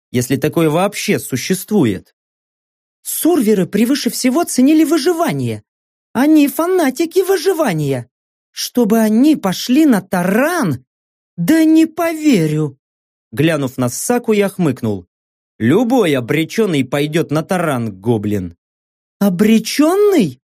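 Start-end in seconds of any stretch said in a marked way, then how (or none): 3.0-12.8 high-pitched, insistent female disbelief; incredulous Saka. Acted. Saka rejecting the ramming theory, high incredulous voice. 19.2-20.4 slow, high questioning echo of ОБРЕЧЁННЫЙ; questioning disbelief. Acted. Saka querying narrator's word, high puzzled question.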